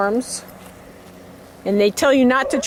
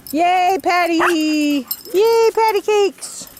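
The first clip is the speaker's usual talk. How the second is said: high, drawn-out yay; slow excited cheer; 7 semitones higher, slower than usual. Acted. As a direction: cheer the dog on slowly. Cheering scared dog.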